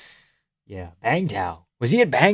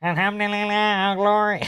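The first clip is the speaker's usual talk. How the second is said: higher squeaky voice; mock him with a higher squeak; squeaky Zalal voice; 9 semitones higher than usual. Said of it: "his squeaky ass voice".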